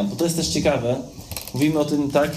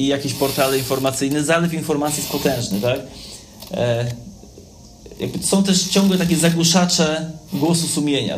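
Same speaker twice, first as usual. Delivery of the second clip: ordinary delivery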